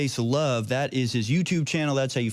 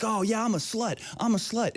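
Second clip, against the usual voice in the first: higher, mocking voice of a boastful protester; mocking proud protester; 8 semitones higher than usual. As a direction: ham up a boastful protester, pitched up. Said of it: "these women will be like".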